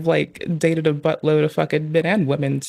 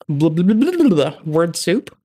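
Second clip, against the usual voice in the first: mocking blah blah; 4 dB louder than usual. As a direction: babble it like empty word soup. Mocking evasive non-answers.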